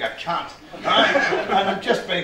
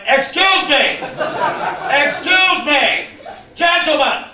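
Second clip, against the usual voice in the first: high, loud, indignant interjection; Edwardian heckler; 10 semitones higher, 5 dB louder than usual. Retold or imitated. Heckler interrupting two wrestlers' stare, high Edwardian heckler. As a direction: break the silence as a pompous gentleman heckler. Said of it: "I did the Edwardian heckler at the wrestling".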